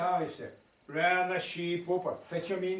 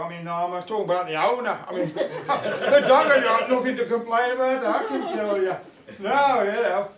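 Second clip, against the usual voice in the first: laughing; 3 semitones higher, 8 dB louder than usual. Real, not acted. Dog laughing at own joke.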